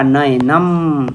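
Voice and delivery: नयनम drawn out very slowly, each syllable apart; slow spelled-out word. Shown as a demonstration. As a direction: stretch नयनम as if writing it on the board. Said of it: "तो ये क्या बन जाएगा?".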